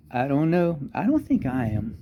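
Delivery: ordinary delivery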